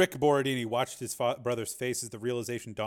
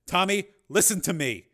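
raised, higher firm plea; urgent plea; 7 semitones higher, 6 dB louder than usual. A narrator's reading. Father pressing his son over the radio, firm and raised. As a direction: make him listen.

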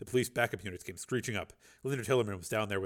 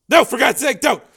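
terrified begging; 16 dB louder than usual. Acted.

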